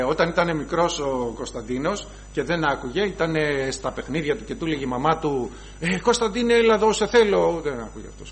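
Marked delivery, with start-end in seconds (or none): 5.8-7.6 imitating mum calling her child; higher, light voice calling a child over; mother's thin, high voice. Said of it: "η μαμά του έχει τη λεπτή φωνή".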